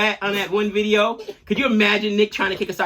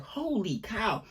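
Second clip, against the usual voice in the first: retold amazement; 10 dB quieter, much slower than usual. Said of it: "I was like".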